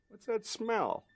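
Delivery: high, mock-puzzled squeak; mock puzzled question. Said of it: "everybody's going like".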